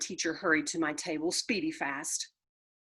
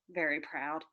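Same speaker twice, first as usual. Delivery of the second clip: usual speaking voice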